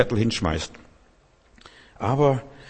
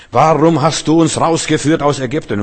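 loud, higher mock bawling at God; whining complaint; 6 semitones higher, 7 dB louder than usual. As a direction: bawl it like a grumbling Israelite. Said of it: "wenn die Israeliten so geplärrt haben". (lautes, weinerliches Plärren)

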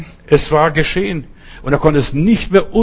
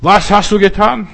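God confronting Cain; 6 semitones higher, 4 dB louder than usual. Acted. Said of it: "Gott sagt zu Kain".

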